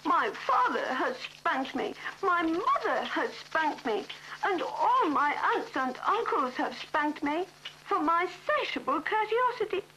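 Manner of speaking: put-on high, plaintive little-child voice; elephant's child. Elephant's child complaining to the snake, high and plaintive. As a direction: list the spankings like a wronged small child.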